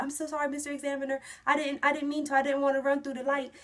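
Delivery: panicked apology. Retold or imitated. Begging the examiner after running light.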